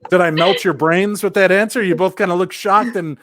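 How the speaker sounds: high laughing teasing question; laughing tease. Real, not acted. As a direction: tease them through a laugh. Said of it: "laughing teasing question".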